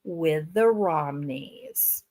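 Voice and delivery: slow, low, drawn-out with the Romneys; air-quoted phrase. Shown as a demonstration. Mocking her family boast, slow and sly. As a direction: say with the Romneys slowly, as if in quotation marks. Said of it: "quotation marks".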